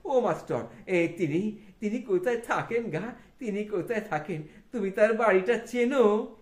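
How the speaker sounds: Thakur's voice. Acted. Thakur asking the schoolmaster the way, lightly voiced. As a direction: ask eagerly like a village saint.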